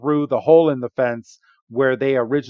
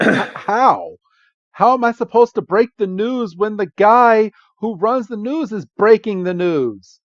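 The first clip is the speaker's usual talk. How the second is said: exasperated question; 8 semitones higher than usual. Retold or imitated. Lucy exasperated.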